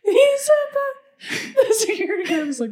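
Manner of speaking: normal talking voice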